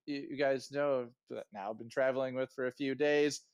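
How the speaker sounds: ordinary delivery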